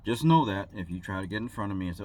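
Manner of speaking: ordinary talk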